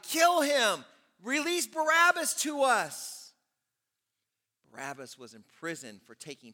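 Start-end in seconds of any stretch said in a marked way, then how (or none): 0.0-3.3 loud, high shout; crowd shouting. Acted. The crowd in Luke demanding Barabbas, loud shout. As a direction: yell it as one furious crowd. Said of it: "they shouted".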